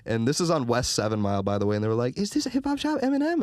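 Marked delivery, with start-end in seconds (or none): 2.2-3.4 imitating tourist lady.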